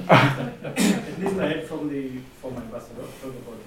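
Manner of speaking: laughing through the words; chuckling while talking. Real, not acted. Amused remark about being mentioned.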